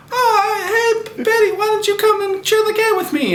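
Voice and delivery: high, sweet mock girly voice; hammed-up party girl offering a ride; girly friend voice. One girl offers Betty the cab, in a high mock girly voice. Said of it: "one of them's like".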